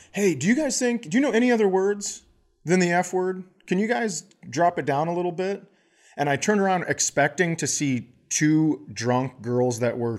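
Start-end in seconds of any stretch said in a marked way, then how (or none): 0.1-5.6 higher, fast mock-polite ask; sweetly asking them to tone it down; retold polite request. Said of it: "I turned around and I go".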